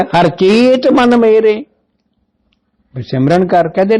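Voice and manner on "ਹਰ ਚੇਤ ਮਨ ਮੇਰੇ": raised, slow intoning of the verse; scripture recitation. Acted. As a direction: lift the line up and draw it out. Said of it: "ਪਹਿਲਾਂ ਵੀ ਕਿਹਾ". (ਉੱਚੀ ਤੇ ਹੌਲੀ ਗੁਰਬਾਣੀ ਦੀ ਤੁਕ)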